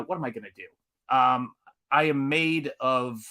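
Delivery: normal talking voice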